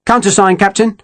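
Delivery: high-pitched sentry's demand. A narrator's reading. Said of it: "Reed demanded".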